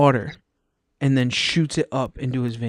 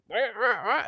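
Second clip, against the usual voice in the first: high wah-wah cries, softer; mock wailing; 9 semitones higher, 4 dB quieter than usual. Buddy in the swamp, imitated high wailing. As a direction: imitate a man floundering in a swamp. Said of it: "all you can hear is".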